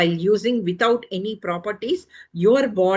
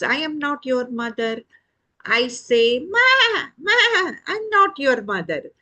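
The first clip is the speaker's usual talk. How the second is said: kindly goat; 5 semitones higher than usual. Acted. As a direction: play a goat cooing at a chick. Goat figure answers the chick.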